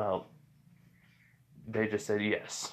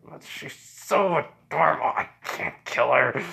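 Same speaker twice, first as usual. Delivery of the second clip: higher, strained mock wail; anguished gushing; 4 semitones higher, 5 dB louder than usual. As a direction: wail it, hopelessly won over. Killer torn by adorable child.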